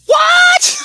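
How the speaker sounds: high, loud disbelieving shout; shocked shout. Retold or imitated. To his buddy who just said he has a girlfriend, a shocked high shout. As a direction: yell it in total disbelief.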